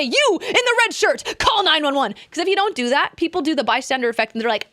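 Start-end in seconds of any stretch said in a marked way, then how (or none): 0.0-2.1 single one person out and command; commanding call. Shown as a demonstration. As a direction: bystander ordered to call for help. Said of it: "You have to say".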